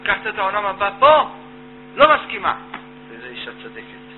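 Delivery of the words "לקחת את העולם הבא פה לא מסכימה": wife's loud protest. Acted. Wife protests to husband.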